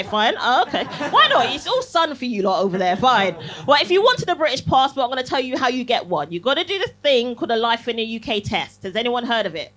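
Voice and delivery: normal talking voice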